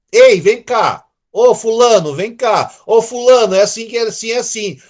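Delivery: loud, friendly call to a buddy; casual calling out. Retold or imitated. Preacher imitating calling a friend casually. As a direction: holler it to a friend across the room. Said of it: "Como que você fala com a pessoa".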